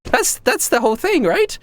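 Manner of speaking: very high, gushing and excited emperor voice; giddy young emperor. Acted. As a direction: squeal each greeting like a flustered young ruler. Same emperor voice.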